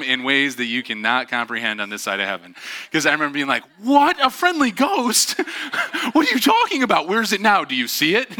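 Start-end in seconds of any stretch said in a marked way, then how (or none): 3.8-5.5 put-on high, loud alarmed squeal of a frightened kid; shocked child. A six-year-old quizzing the teacher about the ghost, panicked and high. 6.1-7.1 high, loud startled "What are you talking about?"; alarmed child. Retold or imitated. A scared kid to his teacher, high and alarmed. 7.1-8.3 put-on high, loud alarmed squeal of a frightened kid; shocked child. A six-year-old quizzing the teacher about the ghost, panicked and high.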